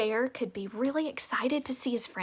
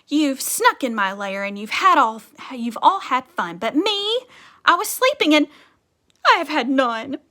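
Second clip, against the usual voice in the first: a lightly voiced sulky bear, louder; grumbling bear; 4 semitones higher, 10 dB louder than usual. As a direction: whine like a grumpy bear who missed the fun. Bear complains in story.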